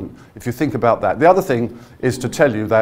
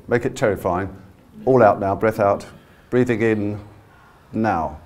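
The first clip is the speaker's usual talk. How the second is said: usual speaking voice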